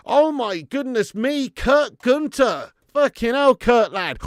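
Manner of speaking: high, loud, drawn-out amazement; excited shout-out. Real, not acted. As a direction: hail the donor in loud, stretched-out disbelief. Celebrating a viewer's donation.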